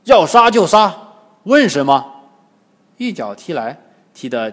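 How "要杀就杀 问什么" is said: short, forceful roar of defiance; loud defiant shout. A narrator's reading. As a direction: bellow it defiantly at the captor. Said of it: "沙和尚大喝". (沙和尚大喝一声)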